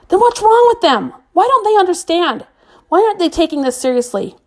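put-on exasperated girl ranting at her friends; frustrated outburst. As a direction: demand an answer from them all. Jen raging at the chat.

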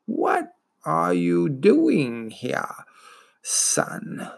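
very slow, wheezing voice of an ancient turtle; wheezing, gurgling old turtle. Acted. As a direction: let it gurgle up out of a swamp. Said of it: "The wheezing, gurgling voice spoke again".

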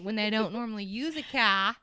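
put-on a lightly done New York accent on the word car; mock New Yorker, leaning on car; playful hint of a New York accent. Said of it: "the only word I can say with the accent".

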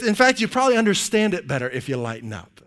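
ordinary delivery

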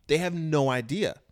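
normal talking voice

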